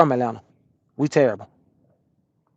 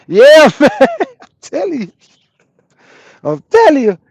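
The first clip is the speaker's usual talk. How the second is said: high, loud laugh through the words; cracking up mid-sentence; laughing agreement; 11 semitones higher, 7 dB louder than usual. Real, not acted. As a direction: laugh while insisting, voice shooting up. Laughing at a viewer's Bonehead comment.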